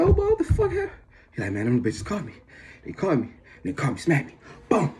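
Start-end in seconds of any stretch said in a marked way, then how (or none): 0.0-0.9 very high squealed disbelief; mock shocked question. Acted shocked friends seeing beaten friend. 1.5-4.9 higher-voiced imitation of a beaten friend; acted beaten-up complaint.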